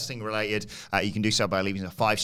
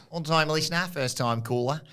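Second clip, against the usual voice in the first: ordinary talk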